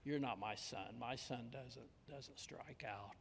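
low, soft, cold father fading to a whisper; cold rejection. Retold or imitated. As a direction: disown him quietly, ending in a whisper. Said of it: "the father looked at him and said".

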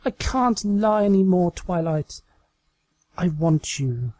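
mock confession. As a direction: confess it like a romance heroine.

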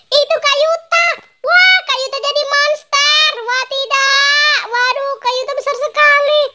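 put-on a scared kid shrieking it out; panicked alarm. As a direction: wail in horror as the brother turns into a monster. Discovers brother became a monster.

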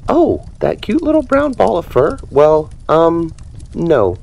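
high Dory voice, gushing then hesitant; gush, then stall and sheepishly admit; lightly voiced ditzy fish owning up; sheepish admission. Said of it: "Dory admitted".

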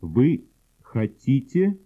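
normal talking voice

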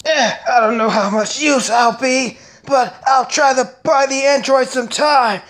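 rapid-fire anime character voices; switching impression voices. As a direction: jump from villain to villain. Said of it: "if I do well, let me know".

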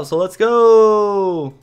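rally everyone to head out; excited. Real, not acted. Gamer urging himself onward, eager cry.

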